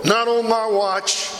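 loud, slow, high refusal; defiant protest. Retold or imitated. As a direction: refuse him loudly and slowly. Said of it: "I just grabbed him and says".

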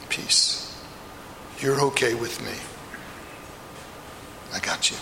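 soft, breathy comfort; tender whisper. Acted. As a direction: hold him close with the words. Risen Jesus comforts Peter.

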